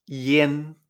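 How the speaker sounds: "yen" with a clear E, not A; Chinese yen. Shown as a demonstration. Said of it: "chino se pronuncian".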